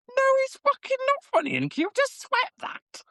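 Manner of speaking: very high squeaky put-on voice, slowing at the end; mock outraged inner voice. Inner reply about the shavings, very high squeak. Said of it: "I was like".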